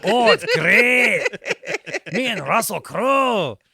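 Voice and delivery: loud, high, stretched-out fake Indiana accent; mock Indiana accent. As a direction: brag in an overblown Indiana accent. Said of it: "Listen to my accent".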